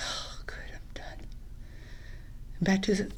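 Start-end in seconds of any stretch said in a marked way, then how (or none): 0.1-1.3 whispered, tired relief; relieved whisper. Retold or imitated. Boy alone after school, whispered relief. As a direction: breathe it out like the day's finally over.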